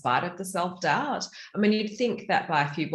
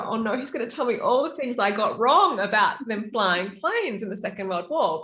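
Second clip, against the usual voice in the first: mock dread; 6 semitones higher than usual. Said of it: "your natural reaction is to go".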